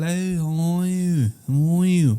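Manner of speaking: slow, stretched fake British greeting; mock British accent. Comedian showing his disguise as a pop star, mock British accent. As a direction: put on a hammy British accent. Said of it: "I'll get a British accent".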